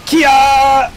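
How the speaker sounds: high, loud martial-arts shout; battle cry. Pretend. Player firing off a special move, shouted battle cry. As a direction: let out a sustained "kiai" yell.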